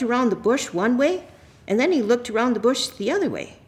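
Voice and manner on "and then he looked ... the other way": ordinary talk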